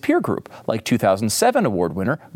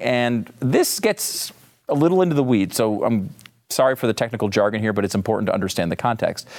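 normal talking voice

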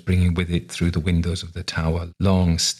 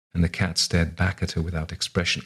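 ordinary delivery, nothing marked

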